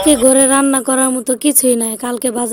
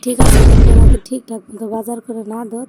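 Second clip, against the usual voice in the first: loud, sharp angry threat; angry ultimatum; 8 dB louder than usual. Acted. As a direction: throw down the ultimatum. Wife threatens to leave.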